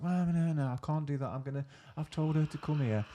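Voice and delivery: high, fretful mock old lady refusing; hammed-up granny protesting; fussy colleague. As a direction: squeak out a flustered no. Voicing older colleague refusing.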